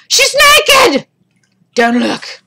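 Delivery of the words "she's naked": high, loud shocked exclamation; shocked shout. Acted. Reacts to game scene.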